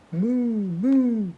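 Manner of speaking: high playful moo; cow moo. Pretend. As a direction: moo for the toddler. Parent recap of sounds.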